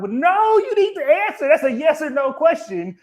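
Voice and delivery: high, loud, fed-up insistence; exasperated demand. Retold or imitated. Wife pressing her husband for a straight answer, loud and exasperated. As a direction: push back hard and refuse to let it slide.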